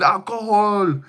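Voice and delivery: high, put-on whiny voice; mocking the streamer. Streamer imitates a streamer reacting to alcohol, high mocking voice. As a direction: squeak it like a mocking kid. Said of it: "he said".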